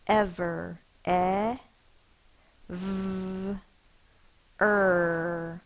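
each part said apart and clear; sounding it out. Shown as a demonstration. Teacher segmenting the word for young spellers, sound by sound. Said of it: "Let's sound it out".

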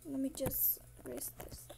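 soft whispered aside; teacher whispering while erasing the board. Real, not acted. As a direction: whisper it to yourself.

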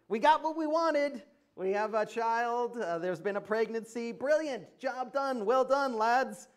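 high, loud self-congratulation; hammed-up hearty congratulations; mock celebration. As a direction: cheer your rubbish success in mock triumph. Mock celebration of their rubbish victory.

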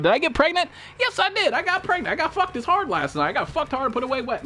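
high-pitched hammed-up boasting; mock confession. Joking mock confession, played up.

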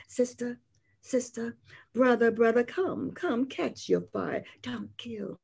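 hushed, calling softly to sister and brother; softly calling the young to come; soft poetic call. Acted. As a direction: beckon gently, almost a murmur.

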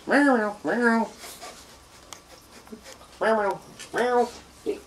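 high playful kitty meows; little kitty meow. Pretend. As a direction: meow like a tiny kitten. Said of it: "That's a little kitty".

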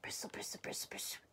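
whispered brushing sound effect; toothbrush noise. A narrator's reading. Mouse brushes teeth while Bear lies in bed, whispered bristling. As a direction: hush the words into bristly scrubbing. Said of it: "It was Mouse brushing his teeth".

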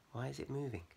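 ordinary delivery

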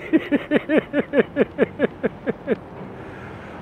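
long airy laugh; breathy laugh. Real, not acted. Laughs at guitar.

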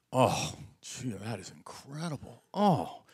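soft, low, groaning pleasure at the food; low, hushed food groans; hushed delighted moaning. Retold or imitated. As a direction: savour every bite with a moan. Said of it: "this is what you will hear".

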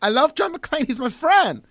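put-on high, adoring European accent; besotted fan with a put-on European accent; Rennie Harlan. Director declaring love for his friend, European accent. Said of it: "Rennie Harlan, Die Hard two is like".

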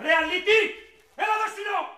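angry chase shout. Real, not acted. Father chasing his son.

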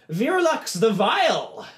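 high, loud, slow name reveal; announcer's call. Acted. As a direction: stretch the name out for the hall. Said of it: "pulls out another thing and he goes".

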